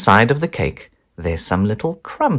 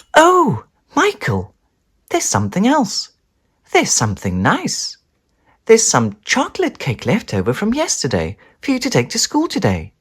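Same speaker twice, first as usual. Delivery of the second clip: higher, bright mum calling; mum's voice; 10 semitones higher than usual. Acted. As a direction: remind him kindly. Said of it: "she says".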